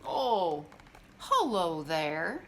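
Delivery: slow, low-key bear mutter; lightly voiced bear, slow and mumbling. A narrator's reading. Rufus Bear greets his startled friends with a slow mutter. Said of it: "muttered the shadow".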